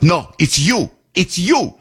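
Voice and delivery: put-on foreign-accented accusation; grumpy accusing tone. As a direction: imitate a curt, blaming clerk. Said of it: "With that tone to me like that".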